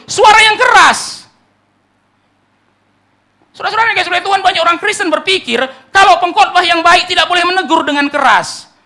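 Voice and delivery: high, loud, impassioned sermon shout; full-force preaching. Real, not acted. Preacher rousing a sleeping church, full-force preaching. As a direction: cry out to wake a sleeping church.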